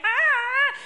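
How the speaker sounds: high, loud "ah"; sudden high cry, played up; imitated cry. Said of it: "he was going".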